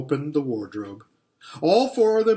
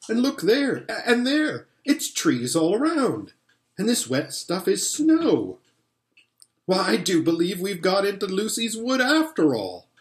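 higher-pitched, dawning amazement; excited discovery; 7 semitones higher than usual. A narrator's reading. Boy showing siblings trees and snow, excited discovery. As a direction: point things out with growing wonder.

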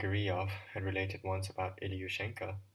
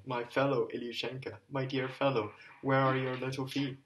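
loud, higher grieving cry; crying aloud; 5 semitones higher, 6 dB louder than usual. Acted. A father cries aloud over his son's boot. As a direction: cry out to the lost boy in grief. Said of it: "crying aloud".

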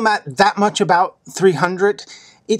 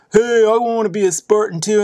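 raised, eager misfit voice; mock a hopeful outcast, pitched up; hunchback character; 4 semitones higher than usual. Hunchback asking to be a Spartan, higher put-on voice. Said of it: "this, like, hunchback dude comes".

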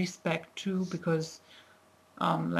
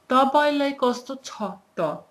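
clear loud model line; 6 semitones higher, 5 dB louder than usual. Shown as a demonstration.

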